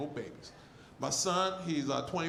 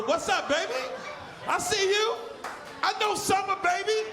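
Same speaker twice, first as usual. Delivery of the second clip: excited greeting; 9 semitones higher than usual. Acted. Greeting audience member.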